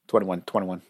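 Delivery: low, mumbled rapper chant of the number. Retold or imitated. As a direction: lightly mimic a rapper's hook, low and soft. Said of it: "I'm mimicking [name]".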